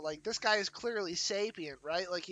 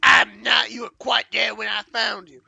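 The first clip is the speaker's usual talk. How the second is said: granny voice; 3 semitones higher, 14 dB louder than usual. Acted. Granny voice denying.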